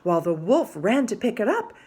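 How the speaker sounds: plain reading voice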